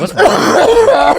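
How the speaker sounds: squeaky high shocked yelp; shocked cry. Retold or imitated. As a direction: jump up in alarm at the coughing friend. Table reacting to vodka order.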